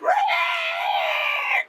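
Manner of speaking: very high, drawn-out mock screech; imitated screech. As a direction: screech like the cut kid, held long. Imitates boy's screech after being cut.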